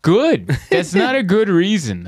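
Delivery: high, drawn-out comic exasperation; indignant protest. Retold or imitated. Comedian rejecting British audience's excuse, indignant protest.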